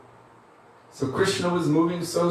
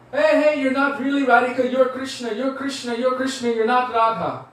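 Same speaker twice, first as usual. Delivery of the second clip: calling out; 8 semitones higher than usual. Pretend. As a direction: shout up at the stage to break the spell. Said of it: "you're calling out from the audience".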